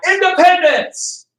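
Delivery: slow, high triumphant cry of independence; mock cheer. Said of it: "they're like".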